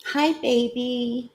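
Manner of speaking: soft, high, slow coo. Real, not acted. Owner greeting her baby, cooing baby talk. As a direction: coo to a beloved little pet.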